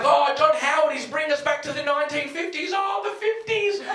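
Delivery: high, whiny mock alarm; mock naive outrage. Young crowd wails about John Howard in mock horror. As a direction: play the naive young lefty, all fake horror. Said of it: "And we were like".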